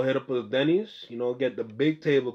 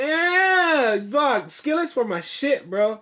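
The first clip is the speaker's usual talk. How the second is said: high, loud and emphatic; excited; 12 semitones higher, 5 dB louder, much slower than usual. Real, not acted. Friend raves about his old Denny's skillets, excited. Excited recall of favorite dish.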